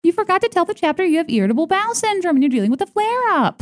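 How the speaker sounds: high, chirpy mock announcement; tactless announcer parody. Hypothetical girl announcing Susie's private illness, high chirpy mockery. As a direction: lampoon someone outing a private illness. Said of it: "you just don't need to be like".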